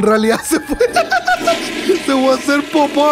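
talking through real laughter; cracking up. Real, not acted. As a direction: break into laughter mid-sentence, slowing as it takes over. Cracks up telling the joke. (hablando muerto de risa)

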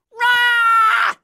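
loud high fighting yell; battle cry. Acted. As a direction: let out a big attack shout. Hero swings in battle.

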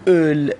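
اول with rounded lips and a drawn-out ö; rounded o-umlaut. Shown as a demonstration. Teacher shows Iranian learners a hard vowel, اول with long rounded ö.

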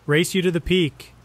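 high, loud playful call; buddy Alex calling out a race to the peak, excited; excited challenge. A narrator's reading. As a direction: shout it as you dash off.

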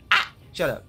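ordinary delivery

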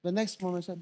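ordinary talk, nothing marked

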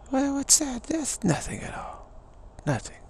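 high-pitched, dismissive British voice; British aristocrat. Acted. Aristocrat dismissing suffering, airily unconcerned. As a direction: wave it away in a high airy voice. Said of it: "And the British would be".

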